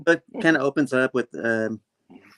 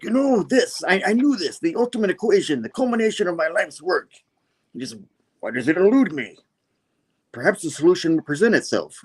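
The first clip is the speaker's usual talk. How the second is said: higher, theatrical supervillain voice; villain monologue; 7 semitones higher than usual. Acted. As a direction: muse grandly like a comic-book mastermind. Acted villain's brooding monologue.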